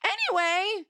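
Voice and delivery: a high, breezy change of subject; dismissive high brush-off. Retold or imitated. Brushing off the cop's question.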